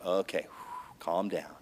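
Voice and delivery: very soft exhale, calm down; quiet whew, settling himself; self-calming. Retold or imitated. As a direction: talk yourself down under the breath. Calming himself after anger.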